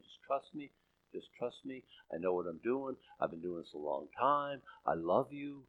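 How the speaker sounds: soft, low, tender voice of God; hushed, loving reassurance; gentle reassurance. Acted. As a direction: reassure very quietly, like a patient father. God reassuring a worried believer.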